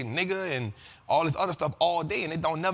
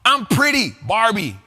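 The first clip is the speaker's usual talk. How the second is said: loud punchy [name]-style one-word tags; imitating [name]; 4 semitones higher, 8 dB louder, slower than usual. Host mimicking [name]'s old catchphrase lines, loud and punchy. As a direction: snap each line then drop the tag word. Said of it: "when she used to talk like that".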